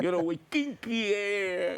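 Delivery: slow imitation of a heavy Puerto Rican accent. Retelling the reverend's rally speech, in a slow Puerto Rican accent. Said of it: "speaks with a heavy accent".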